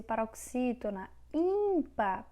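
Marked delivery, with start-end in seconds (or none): 1.3-2.2 ÍMpar, high and drawn-out ÍM; stress on first syllable. Shown as a demonstration.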